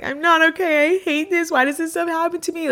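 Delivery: high, whiny mock crying; hammed-up high wail; overwrought, in her feelings. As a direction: imitate a tearful self-pitying meltdown. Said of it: "very much in my feelings".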